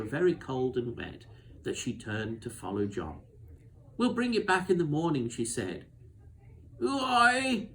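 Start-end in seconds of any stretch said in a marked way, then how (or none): 4.0-5.3 quick and a bit louder, higher; girl calling out quickly as she hurries away; hurried promise. A narrator's reading. Susan hurrying after John in rain. 6.8-7.7 much higher and louder, strange unearthly tone; a ghostly voice out of nowhere; a scarecrow's uncanny cry carried on the wind; eerie scarecrow voice. A narrator's reading. Mysterious voice from the Scarecrow.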